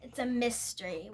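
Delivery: ordinary delivery, nothing marked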